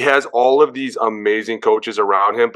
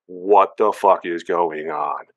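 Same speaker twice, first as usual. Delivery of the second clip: stunned disbelief; 6 semitones lower, much slower than usual. Retold or imitated. Teammates reacting to Russ leaving.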